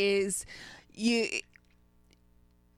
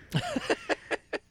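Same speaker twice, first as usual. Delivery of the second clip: giddy high laugh; 3 dB quieter than usual. Real, not acted. Delighted about softness.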